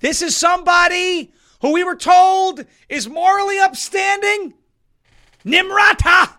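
high, loud sarcastic shout; mocking shout. Real, not acted. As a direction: bellow it with scorn. Mocking her moral reputation.

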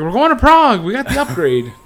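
loud, high-pitched gleeful announcement; excited teacher. Retold or imitated. Teacher announces the Prague trip to students, excited. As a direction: shout it out like a thrilled teacher.

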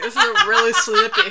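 hammed-up mock British accent, cracking up; terrible British accent. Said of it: "That was a terrible attempt at a British accent".

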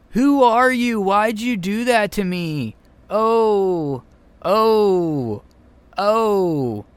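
high, limp mock victim cry; weak bad acting. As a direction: ham up a feeble hurt whimper. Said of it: "is incredibly weak".

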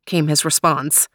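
ordinary delivery

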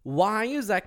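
ordinary talk, nothing marked

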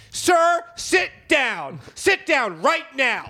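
high, loud, emphatic order; mock stern shout. Passenger ordering the hijacker to sit, stern shouting. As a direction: punch each command slowly.

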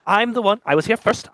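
a hint of a puppet master's voice; puppeteer voice. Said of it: "your voice sounds very, very familiar".

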